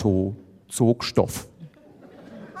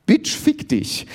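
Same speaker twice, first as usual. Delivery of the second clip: slow, high-pitched curse; venomous insult; 14 semitones higher, slower than usual. A narrator's reading. Iris cursing Elke at the summit, venomous. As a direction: spit out a high, drawn-out insult. Acted insult, then apologises.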